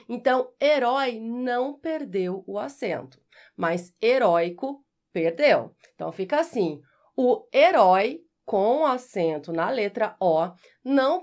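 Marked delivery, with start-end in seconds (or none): none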